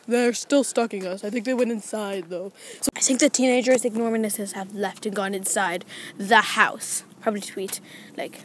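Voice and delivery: usual speaking voice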